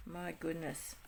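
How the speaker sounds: ordinary talk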